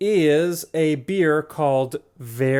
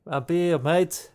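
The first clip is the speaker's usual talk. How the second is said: mock English accent; 2 semitones higher, much slower than usual. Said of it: "All of my English accents".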